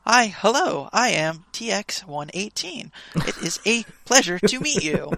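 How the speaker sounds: halting, high-pitched robot greeting; stilted mechanical droid. Retold or imitated. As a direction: deliver each word stiffly, like a droid. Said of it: "the weird stilted droid delivery".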